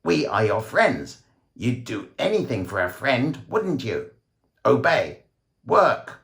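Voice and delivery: croaking alien. A narrator's reading. Alien voice on the TV ordering listeners to obey, a high croaking command. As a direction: bark orders like a bird-like alien. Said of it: "bird-like croaks of the aliens".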